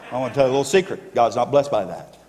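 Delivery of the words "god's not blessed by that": normal talking voice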